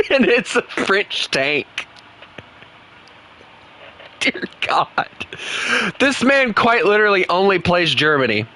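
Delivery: high-pitched, incredulous ribbing; mock exasperation. Real, not acted. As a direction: tease him like it's outrageous. Mocking a German-only opponent.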